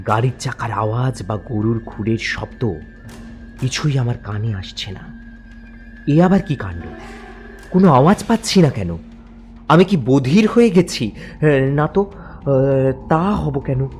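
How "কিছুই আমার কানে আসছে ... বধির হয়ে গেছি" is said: plain reading voice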